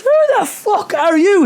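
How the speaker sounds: very high, loud, drawn-out demand; imitating a stranger losing patience, high and loud; mock exasperated yell. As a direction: yell it in hammed-up disbelief.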